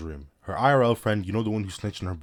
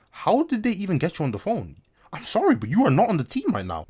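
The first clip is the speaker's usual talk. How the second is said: mock exasperation; 10 semitones higher, 3 dB louder than usual. Narrator mock-scolds the child caller.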